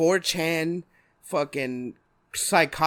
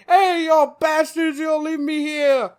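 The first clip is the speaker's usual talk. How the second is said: high, loud, drawn-out gangster complaint; Penguin; 11 semitones higher, 8 dB louder, slower than usual. Acted. Handcuffed villain yelling at those leaving him, high holler. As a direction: holler after them in mock outrage. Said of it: "He's like".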